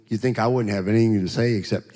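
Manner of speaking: normal talking voice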